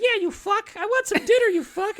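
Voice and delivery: dad mimicking his toddler in a tiny voice. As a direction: squeak it out like a toddler swearing. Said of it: "a little tiny voice be like".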